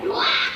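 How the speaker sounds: short, high-pitched exasperated yell; sudden wordless high yelp; mock yell. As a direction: let out a mock scream of frustration. Acting out her car yell.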